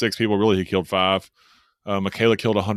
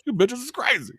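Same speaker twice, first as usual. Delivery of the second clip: imitating Big Al. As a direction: squeal it out as the shocked big guy. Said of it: "He's like".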